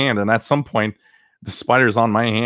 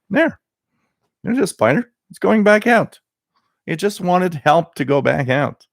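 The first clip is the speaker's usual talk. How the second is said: excited; 8 semitones higher than usual. Retold or imitated. Showing spider leaving.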